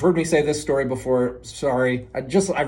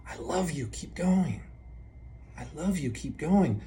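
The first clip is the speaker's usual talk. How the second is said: soft, slightly higher, tender voice; soothing self-encouragement; 3 semitones higher, 6 dB quieter than usual. Shown as a demonstration. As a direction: gently soothe the anxiety, unhurried. Soothing phrase offered against anxiety.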